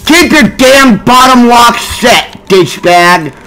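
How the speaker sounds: real furious yell. Real, not acted. Gamer yelling at glitching game.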